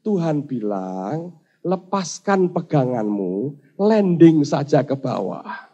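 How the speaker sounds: drawn-out, unhurried message; slow, calm relay. Acted. Angel relaying God's order to let go, slow and calm. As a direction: spell out the order gently and slowly.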